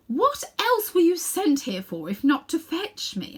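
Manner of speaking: loud, lofty queen's voice; haughty queen. A narrator's reading. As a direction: talk down to a common boy. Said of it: "asked Jadis".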